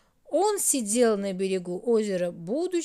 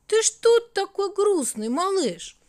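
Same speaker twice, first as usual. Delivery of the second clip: high, loud surprised question; 9 semitones higher, 5 dB louder than usual. A narrator's reading. Mother Night surprised at her sad son, high and loud. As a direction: ask the son, startled. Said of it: "удивилась Ночь".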